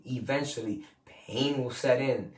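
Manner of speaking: ordinary delivery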